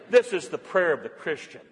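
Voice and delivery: ordinary delivery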